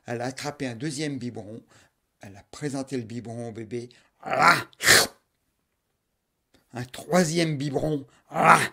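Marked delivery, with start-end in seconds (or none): 4.2-4.7 loud gulping ah; loud monster gulp. Acted. Monster swallowing the second bottle, loud gulp. 8.3-8.6 loud gulping ah; loud monster gulp. Acted. Monster swallowing the second bottle, loud gulp.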